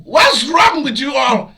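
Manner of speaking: high, loud exasperated cry; outraged shout. Real, not acted. Ranting at people who eat strange animals, loud and outraged. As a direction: cry out in disbelief at the world. Outraged rant at foreign eaters.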